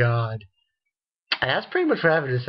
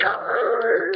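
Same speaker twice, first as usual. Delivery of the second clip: angry growl; 10 semitones higher than usual. Retold or imitated. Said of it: "She's like".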